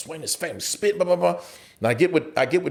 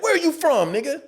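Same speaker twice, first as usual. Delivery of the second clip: confrontational challenge; 9 semitones higher than usual. Retold or imitated. Retold face-to-face gang challenge.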